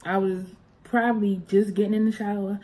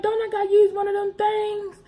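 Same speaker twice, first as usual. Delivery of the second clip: put-on high child voice; 12 semitones higher, 3 dB louder than usual. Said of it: "So I was like".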